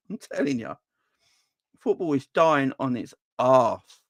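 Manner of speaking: usual speaking voice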